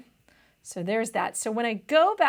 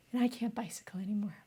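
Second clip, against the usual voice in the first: whispered aside; 9 dB quieter, faster than usual. Retold or imitated. Old man voice drops to whisper.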